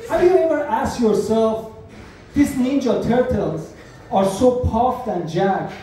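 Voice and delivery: ordinary talk, nothing marked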